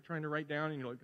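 ordinary talk

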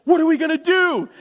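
high, loud mock panic about a surprise guest; hammed-up freak-out, pitch jumping up. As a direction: squeal it like the house is a disaster. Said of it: "we freak out".

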